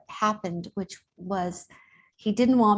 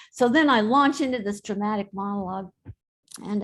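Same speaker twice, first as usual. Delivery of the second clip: ordinary talk, nothing marked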